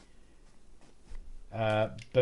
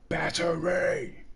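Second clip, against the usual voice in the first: drawn-out movie cheer; 4 semitones higher, much slower than usual. Retold or imitated. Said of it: "Him and Halle Berry doing".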